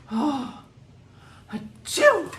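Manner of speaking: high, loud hammed-up oh, achoo; mock sneeze. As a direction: build up and blast a fake sneeze. Acting out allergic sneezing.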